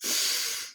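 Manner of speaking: a soft exhale through the nose; nose-only breath out. Shown as a demonstration. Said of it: "If I breathe like this".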